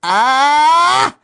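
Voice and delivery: high surprised ghostly shriek; startled cry. A narrator's reading. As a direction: gasp out in shock. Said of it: "Überrascht fuhr sie zurück".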